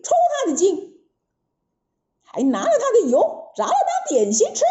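high, cackling monster threats; boasting demon. Acted. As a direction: gloat and laugh over the threat. (妖怪得意地狂笑威胁)

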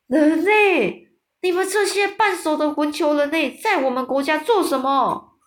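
high, loud storybook bellow; giant shouting. A narrator's reading. Man-eating giant confronting the human soldiers, shouting. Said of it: "吞噬巨人大叫".

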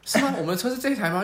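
surprised question. Retold or imitated. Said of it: "我们还想说".

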